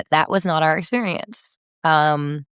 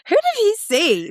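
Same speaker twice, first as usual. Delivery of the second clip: puzzled wonder. Retold or imitated. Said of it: "And I was like".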